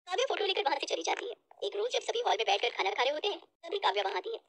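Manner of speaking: usual speaking voice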